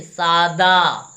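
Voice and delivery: सादा stretched slowly with a plain d, no aspiration; slow correct form. Shown as a demonstration. Teacher modelling the right word for the class, slow. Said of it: "क्या होना चाहिए?".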